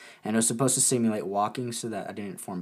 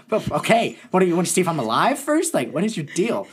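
sarcastic; 7 semitones higher, 6 dB louder than usual. Retold or imitated. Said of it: "I was like".

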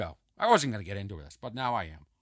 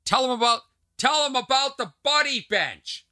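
higher, louder imitated yell; sister yelling out; 8 semitones higher, 4 dB louder than usual. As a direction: call it out like an eager sister. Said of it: "my sister yelled out".

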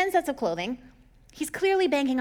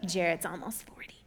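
whisper; 4 semitones lower, 5 dB quieter than usual. Real, not acted. Teasing aside about Jared.

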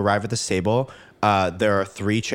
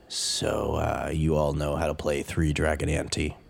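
deep dragonborn voice; 7 semitones lower, 5 dB quieter than usual. Acted. Dragonborn examiner speaks.

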